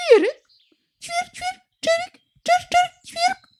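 high, quick squabbling bird voice; sparrow voice. A narrator's reading. Sparrow scolding the singing nightingale, high and chirpy. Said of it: "Na to wróbel zaterlikał".

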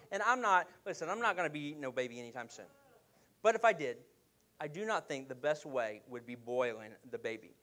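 ordinary delivery

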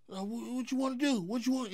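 high-pitched mocking imitation of a challenger; Cat Williams. Comedian retelling his rival's challenge in a standoff, mocking high voice. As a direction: squeal it in a mock falsetto challenge.

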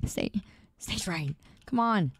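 usual speaking voice

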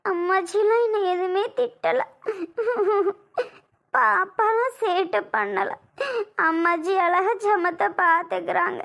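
tearful, sob-broken denial; crying. Acted. Wife tells her husband nobody scolded her, crying. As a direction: talk through sobs, drawing the words out. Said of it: "அப்ப நீ ஏன் அழுகுற?".

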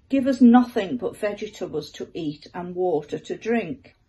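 usual speaking voice

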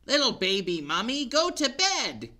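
a hint of a bossy skeleton; lightly voiced skeleton. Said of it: "he said".

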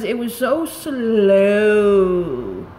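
exasperated, dragging the words out; drawn-out complaint. Real, not acted. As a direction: stretch "slow" out long. Complaining of very slow bath bomb.

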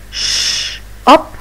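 hushed sh then a bright up; sounding out shop. Shown as a demonstration. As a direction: split "Sh-" and "up" apart. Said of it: "listen and repeat after me".

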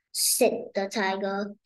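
ordinary delivery

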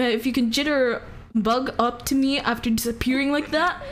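usual speaking voice

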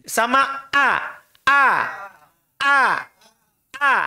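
slow, clipped glottal "a"; "a" caught sharply in the lowest throat; repeated glottal "a" drills; hamzah glottal stop. Shown as a demonstration. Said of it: "Paling bawah".

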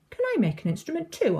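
high, quick, hopeful ask; eager question. A narrator's reading. Eleanor asking to make an instrument, eager. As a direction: ask eagerly to have a turn too. Eager child asks to join.

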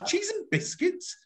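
shocked at the outrageous price; mock outrage. Shown as a demonstration. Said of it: "can you get outrage into your voice for me?".

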